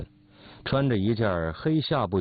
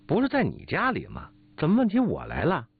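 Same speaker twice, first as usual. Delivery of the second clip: higher-pitched indignant comeback; mildly affronted, pitched-up questioning; indignant retort; 6 semitones higher than usual. Acted. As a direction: throw the question back, offended. 王大嫂被质问时反问.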